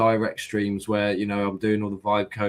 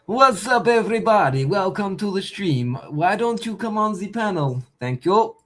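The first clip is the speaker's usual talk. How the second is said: hype streamer greeting; 10 semitones higher than usual. Real, not acted. Streamer greeting his viewers.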